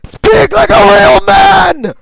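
slow, drawn-out furious yell; shout. Acted. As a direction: bellow it slowly. Shouted taunt again.

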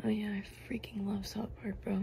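whispered mock enthusiasm; whispering. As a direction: whisper it like a dude bro.

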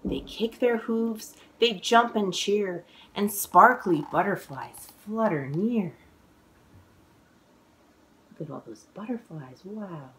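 lively, sing-song picture book reading; reading to children. A narrator's reading. Author reading her book aloud to children, sing-song. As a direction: read the rhymes with bounce for little listeners.

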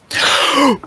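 quick deep intake of breath; sharp gasp. Real, not acted. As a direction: gasp in hard before holding your breath. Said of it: "deep breath".

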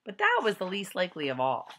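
ordinary delivery

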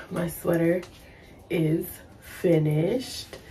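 hoarse, hushed voice; raspy whisper. Real, not acted. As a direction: talk through a worn-out voice. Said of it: "by tomorrow I will not have a voice".

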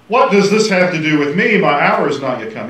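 hammed-up objection, projected; mock refusal. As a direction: push back loudly as if refusing the request. Mock refusal Jesus didn't say.

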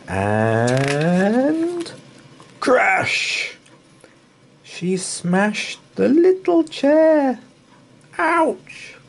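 high, loud, excited narration; dramatic crash. A narrator's reading. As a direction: make the crash big and shocking. Chair breaks, dramatic narration.

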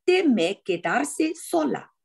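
slow, deliberate model reading with clear word stresses; 慢速领读. Shown as a demonstration. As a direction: lead the learner word by word, pitch lifting. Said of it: "慢一点". (慢速领读，每个单词的重音都读清楚)

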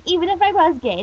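high, loud objection; indignant protest. Acted. Teen protesting as he heads to kitchen, indignant cry.

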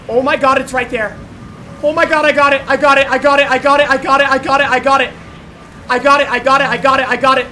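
loud, high frantic repeats; frantic excited gamer yelling; panicking gamer. Real, not acted. Grabbing loot in panic.

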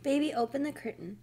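plain reading voice

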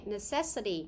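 "Necessity" stressed on the second syllable, ne-CESS-ity. Shown as a demonstration. Teacher models a word often misread, "Necessity" with second-syllable stress. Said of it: "nhấn âm hai".

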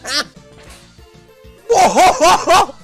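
usual speaking voice

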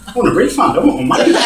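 high and loud, laughing through it; laughing mock complaint. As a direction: ham up the outrage while cracking up. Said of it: "I went".

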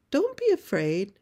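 put-on high, loud call to a child; mother calling reassurance across the tracks. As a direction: holler it over to the boy. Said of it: "calls to her little boy".

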